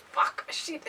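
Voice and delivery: hushed nervous swear; whispered curse. Retold or imitated. Imitating the nervous guy cursing to himself, whispered.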